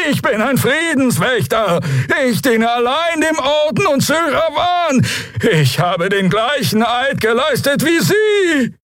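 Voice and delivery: very high, indignant alien cries; screaming Draxyl. Acted. Prisoner swearing loyalty to the order, screaming. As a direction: cry out your loyalty in a high voice. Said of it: "schrie Schuankor".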